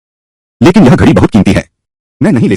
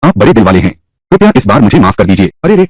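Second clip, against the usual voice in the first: ordinary talk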